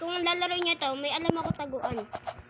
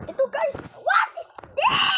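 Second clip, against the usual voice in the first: shocked shout; 9 dB louder than usual. Real, not acted. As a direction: yell it in disbelief. Shocked reaction in game.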